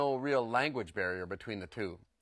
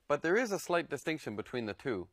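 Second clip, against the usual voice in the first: ordinary talk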